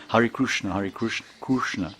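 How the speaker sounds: Krishna said as Krushna with a Kru sound; Oriya pronunciation. Retold or imitated. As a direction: say Hare Krushna like in Orissa. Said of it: "They say it like this".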